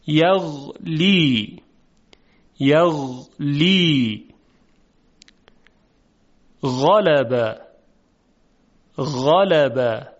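very slow words with smooth voiced غ; soft Arabic ghayn. Shown as a demonstration. Teacher drilling ghayn words for students to repeat. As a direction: stretch each word, gliding the غ softly. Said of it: "صدای حرف غین نرم و روان هست".

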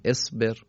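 اصبر begun with "e": esber; kasra on alef. Shown as a demonstration. Teacher answering by the rule, اصبر said "esber". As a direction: open اصبر on a short "e" sound.

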